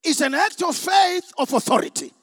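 loud, impassioned preacher's challenge; full-force preaching. Real, not acted. Preacher rebukes doubters in the congregation, at full force. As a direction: drive it home to the whole congregation.

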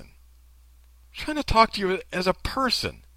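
high, slow imitated sincerity; mock sincere. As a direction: overdo the heartfelt appeal, drawn out. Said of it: "Did I do it as good as her?".

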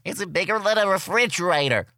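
put-on high, thin little kid voice; lightly voiced kindergartner asking. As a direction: ask it like a curious five-year-old. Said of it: "Someone asked me".

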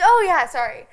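put-on overeager drunk chirp, high and loud; mock bright overeager. Tipsy girl bluffing the check-in staff, overeager chirp. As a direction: sound way too cheerful to pass.

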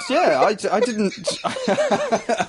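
slow, laughing through words. Real, not acted. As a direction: giggle out the answer. Laughing reply.

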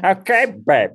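high-pitched comic impression; high, imitated catchphrase; Dennis Miller. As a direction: do a second-hand impression of the satirist. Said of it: "My impression of Dennis Miller is Dana doing Dennis Miller".

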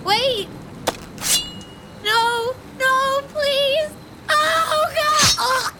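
high, loud panicked screams for mercy; terrified screaming. Acted. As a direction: shriek in sheer terror as the attacker closes in. Victim attacked, screams in terror.

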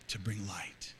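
soft whispered close; hushed altar call. Real, not acted. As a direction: breathe the words over bowed heads. Altar call, heads bowed.

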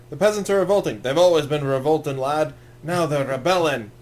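hammed-up impression of a big Scottish warrior; big Scottish guy. Movie fan quoting a warrior about revolting peasants, big Scottish voice. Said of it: "the big Scottish guy".

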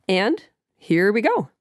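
ordinary delivery

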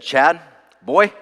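imitated mentor's punchy address, rising sharply on the last word; Pastor Dwight. Pastor imitating his mentor addressing him, punchy rising call. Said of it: "That's how he talks".

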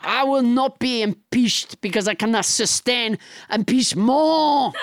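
blustering mock-French proclamation; French Trump. French Trump threatens Biden and Pelosi, blustering French accent. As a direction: declaim it with absurd French vowels. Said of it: "your extremely realistic accent".

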